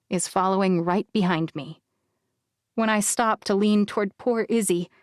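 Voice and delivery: ordinary delivery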